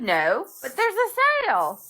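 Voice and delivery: a light mom impression; mom's voice. Son voicing his mother insisting on the sale.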